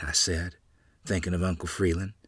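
ordinary delivery, nothing marked

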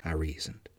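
plain reading voice

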